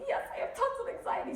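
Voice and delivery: high, breathy mock reply; anxious passenger. Imitating the taxi lady admitting anxiety, high-pitched. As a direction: squeak it out like the nervous lady. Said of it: "are you having some anxiety".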